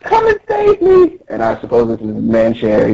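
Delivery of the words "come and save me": very high tiny-princess wail; hammed-up sobby whine; mock crying. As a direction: bawl and plead in a squeaky cartoon voice. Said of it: "And then still crying".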